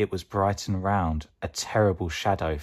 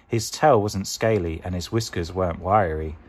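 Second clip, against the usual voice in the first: ordinary delivery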